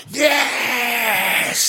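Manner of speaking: high, full-throated cry of joy; triumphant yell. Retold or imitated. Retold leap from the press-box seat with a yelled yes. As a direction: shout it out as the goal goes in. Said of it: "I just went".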